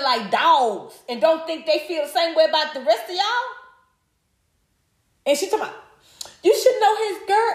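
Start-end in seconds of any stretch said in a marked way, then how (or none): 6.4-7.5 mocking quote. Mocking the guest's words.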